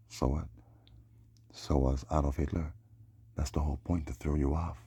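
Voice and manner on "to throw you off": normal talking voice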